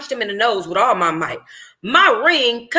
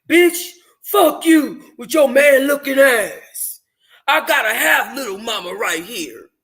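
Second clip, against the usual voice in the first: man's retort; 3 semitones higher than usual. Acted. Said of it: "Kamisha's words fell on deaf ears".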